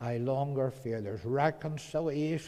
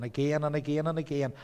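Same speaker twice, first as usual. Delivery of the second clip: ordinary delivery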